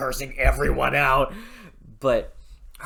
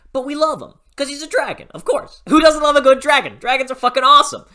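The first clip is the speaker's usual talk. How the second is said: much higher and louder, rushing the words; excited gushing; 12 semitones higher, 11 dB louder, faster than usual. Real, not acted. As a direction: burst out with fan excitement, fast. Gushing about dragons.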